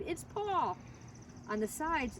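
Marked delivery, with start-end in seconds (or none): 0.0-0.8 high echoing call of the name; excited cry. A narrator's reading. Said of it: "she screamed".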